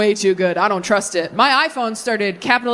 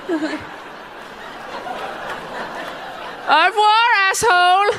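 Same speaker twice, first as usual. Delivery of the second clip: mocking goodbye; 7 semitones higher, slower than usual. Mocking the French stranger.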